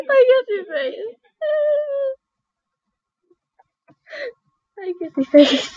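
laughing. Real, not acted. Repeating through laughter.

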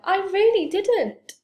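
high, loud insistence; desperate high-pitched denial; cried out. A narrator's reading. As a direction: wail your innocence. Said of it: "Franklin cried".

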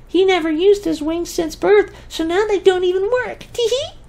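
very high, tiny, cheerful fairy voice; fairy wife voice. Acted. The female fairy cheerfully explains to the twins, very high and tiny. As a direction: chirp it sweetly like a giggly little fairy.

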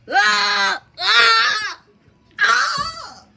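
three short loud yells, rising in pitch; a run of high, loud "ah" screams; startled screams. Pretend. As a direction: cry out in mock alarm. Screams after item throws itself.

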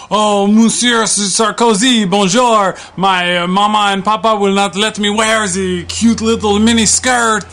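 put-on little French girl. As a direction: whine it like a small French girl begging papa. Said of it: "every little girl in France writing you".

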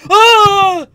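very high, loud scream of fright; real scream; startled scream. Real, not acted. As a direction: shriek at the jump scare. Jump scare.

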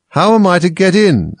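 loud, high, insistent question. Acted. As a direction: raise your voice, demand it. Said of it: "in a louder tone".

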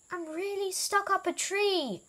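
high, plaintive insistence; calling from up a tree. A narrator's reading.

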